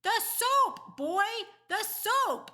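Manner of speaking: loud, very high panicked cries; alarmed shouting. A narrator's reading. Daddy sees soap flying, alarmed high shout.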